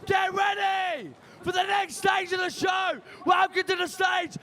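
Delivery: loud, high hype introduction; announcer hyping the next comedian onstage; MC announcer shout. Real, not acted. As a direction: bring the next act on big.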